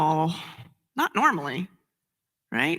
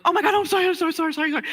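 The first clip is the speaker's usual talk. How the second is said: much higher, a mock near-tears apology; imitating someone close to tears; tearful apology; 9 semitones higher, much faster than usual. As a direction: gush out sorry as if about to cry. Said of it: "almost burst into tears".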